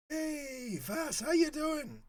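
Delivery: high, loud, slow squeaky greeting with a sore throat; squeaky sick voice. Real, not acted. Greeting a friend with a squeaky sore-throat voice. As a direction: let the cracked high voice show. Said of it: "check my voice out".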